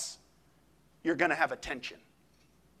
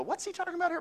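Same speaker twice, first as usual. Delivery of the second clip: mock puzzled self; 13 semitones higher, much faster than usual. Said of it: "study Bible and go".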